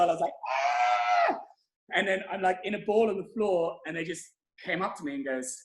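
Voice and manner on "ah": hammed-up startled scream; girly scream. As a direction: let out a short frightened squeal. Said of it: "I was like a little girl".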